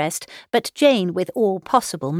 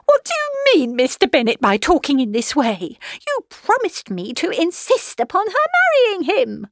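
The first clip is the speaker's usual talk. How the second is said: very high, indignant protest; outraged, piercing complaint; excessively disappointed; 11 semitones higher, 3 dB louder than usual. A narrator's reading. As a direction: wail at your husband's betrayal. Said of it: "was excessively disappointed".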